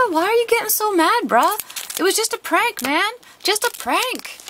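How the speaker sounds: high bewildered bro pleading; shocked Wigglytuff voice. Acted. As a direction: play the baffled prankster. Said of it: "this one's just like".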